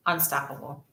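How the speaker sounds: ordinary talk, nothing marked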